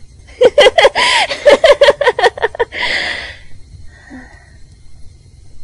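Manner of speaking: high, loud unhinged cackle; maniacal cackle. Acted. Girl cackles madly over her rival's death. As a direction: throw back your head and cackle madly.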